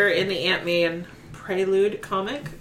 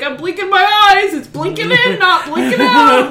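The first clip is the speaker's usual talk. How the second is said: quoting Ted; 10 semitones higher, 8 dB louder than usual. Retold or imitated. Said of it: "and he's like".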